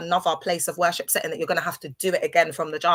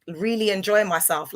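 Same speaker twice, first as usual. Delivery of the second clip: ordinary talk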